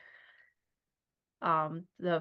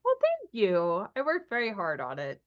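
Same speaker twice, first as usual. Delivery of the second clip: a gentle touch of Irish lilt, grateful. Acted. Irish-lilted assistant thanks the player for the compliment. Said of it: "The Irish lilt is lovely".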